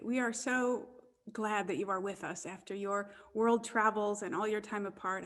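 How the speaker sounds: ordinary delivery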